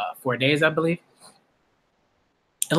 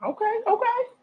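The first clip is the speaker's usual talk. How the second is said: voicing Don and Emma; 6 dB quieter than usual. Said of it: "Don and Emma are like".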